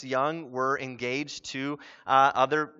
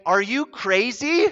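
louder, slow disbelieving question; incredulous; 5 semitones higher, 4 dB louder, slower than usual. Acted. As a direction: ask it in blunt disbelief, rising at the end. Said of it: "That's a really polite way of saying".

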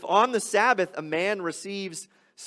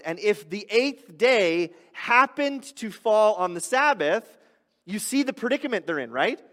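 louder, higher, driving sermon delivery; fervent preaching; 3 semitones higher, 5 dB louder than usual. Acted. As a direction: preach it out to the congregation with fire. Preaching at full force.

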